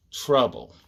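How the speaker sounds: plain reading voice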